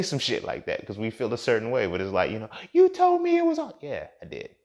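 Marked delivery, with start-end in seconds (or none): 2.7-3.7 very high, loud falsetto protest; mock indignation. Partner throwing his words back at him, mock indignant. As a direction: squeal it like an outraged partner.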